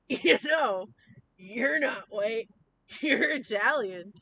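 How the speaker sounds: loud, slow, emphatic dad; imitating an insistent father; dad impression. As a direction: lay down the law like a dad, drawn out. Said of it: "my dad was like".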